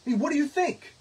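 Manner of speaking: angry retort. Retold or imitated. Sarcastic retort in union meeting.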